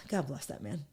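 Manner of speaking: fond sigh. Real, not acted. Thinking fondly of her patient husband, a soft sigh. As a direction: breathe it out with love.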